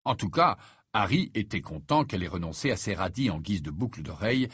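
ordinary delivery

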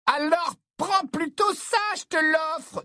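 put-on very high, drawn-out girlish insistence; gushing high-pitched admirer; Romilda Vane. A narrator's reading. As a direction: press a gift on your crush, squeakily. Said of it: "dit Romilda".

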